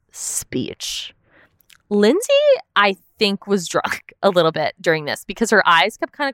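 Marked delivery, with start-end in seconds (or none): 0.1-1.1 whispered aside. Real, not acted. Mocking Sierra's big speech.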